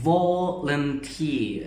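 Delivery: volunteer drawn out very slowly, with a clear V and a falling end; careful pronunciation demo. Shown as a demonstration. Teacher slowly models volunteer for the class. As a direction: stretch out each part of volunteer for the students. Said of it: "มาเบิ่งตัวอิดออกเสียงเอา".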